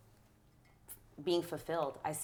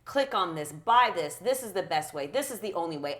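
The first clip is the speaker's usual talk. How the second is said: louder, bossy, pounding commands; pushy influencer; 8 dB louder than usual. Retold or imitated. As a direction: bark orders like a hard sell.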